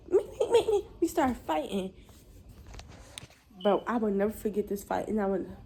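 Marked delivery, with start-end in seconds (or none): 0.1-0.9 high-pitched mock chatter; squeaky little mocking imitation; mocking mimicry. Mimicking her as the fight starts, high mocking chatter. Mocks the other girl's noise.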